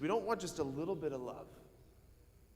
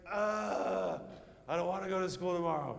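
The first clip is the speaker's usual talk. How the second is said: whiny groan; 3 semitones higher than usual. Retold or imitated. Kid dreading school Monday.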